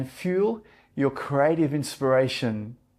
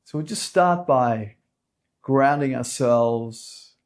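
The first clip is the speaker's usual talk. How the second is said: calm meditation guide, unhurried with long pauses; soothing yoga teacher leading a meditation; guided meditation; 2 semitones lower, 4 dB quieter than usual. Real, not acted. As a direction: settle them gently into stillness, phrase by phrase.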